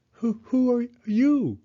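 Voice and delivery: high, slow, stuttered question; startled stammer. Acted. Hedgehog facing a wiggly stranger in the dark, startled stammer. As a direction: stammer it out high and loud, drawing out YOU.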